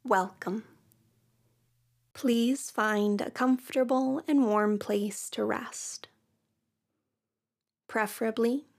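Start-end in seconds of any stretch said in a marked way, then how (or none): none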